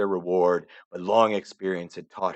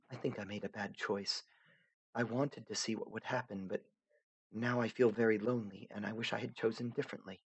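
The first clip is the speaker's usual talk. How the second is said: higher, softer, half-whispered regret; lonely, regretful Aradia; 4 semitones higher, 9 dB quieter than usual. Acted. Aradia admitting to the narrator that she feels lonely. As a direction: confess it quietly, almost to yourself.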